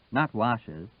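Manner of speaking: usual speaking voice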